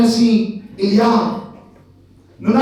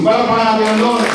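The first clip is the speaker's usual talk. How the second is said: ordinary talk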